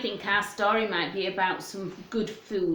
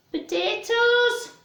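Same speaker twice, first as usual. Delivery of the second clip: excited reveal; 12 semitones higher, slower than usual. Acted. Revealing vegetable to children.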